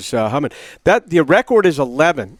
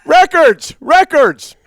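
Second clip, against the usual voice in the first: shouted much higher and louder, twice; triumphant shout; 9 semitones higher, 5 dB louder, slower than usual. Real, not acted. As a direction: bellow it back in triumph. Insisting in a pronunciation argument.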